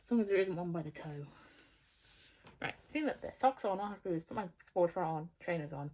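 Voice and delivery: ordinary talk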